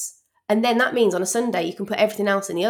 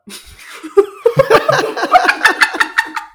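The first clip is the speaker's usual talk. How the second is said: loud, high burst of laughter; a big high peal of laughter; high delighted laugh; 10 dB louder than usual. Real, not acted. Laughing at her own answer.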